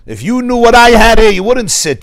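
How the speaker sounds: woman on the bus demanding the seat. Retold or imitated. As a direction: insist on the seat. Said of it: "she says to the other guy".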